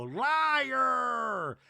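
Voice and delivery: high, loud drawn-out accusation; theatrical mimicked yell; accusing shout. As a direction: yell it out like a mocking chorus. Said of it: "we hear multiple voices go".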